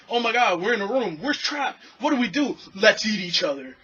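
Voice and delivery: high, loud mock panic; mock panicked tourist. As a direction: squeal it like a crowd losing it.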